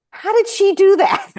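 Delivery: very high, loud incredulous cry; amazed onlookers. Retold or imitated. Workshop group reacting to her trick: very high loud amazement. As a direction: marvel at what just happened.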